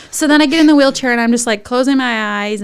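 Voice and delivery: ordinary delivery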